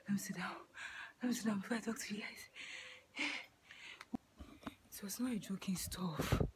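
panting between words; breathless after a hard workout; out of breath. Real, not acted. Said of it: "I'm still panting".

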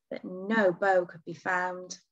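ordinary delivery

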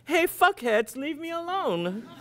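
put-on high, brash kid yelling a dare; cocky taunt. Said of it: "Why don't you say".

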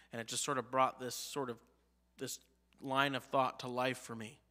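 ordinary delivery

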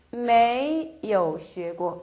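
each syllable spelled out slowly; slow model reading. Shown as a demonstration. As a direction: read it slowly for learners. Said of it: "해도 되고". (천천히 또박또박 읽는 예문)

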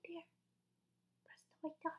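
hurried hushed whisper; urgent whisper. Acted. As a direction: hush them quickly.

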